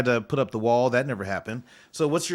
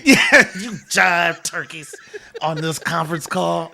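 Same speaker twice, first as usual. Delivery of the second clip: seventies jive talk; 5 semitones higher, 7 dB louder than usual. Retold or imitated. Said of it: "Come on, you jive turkey".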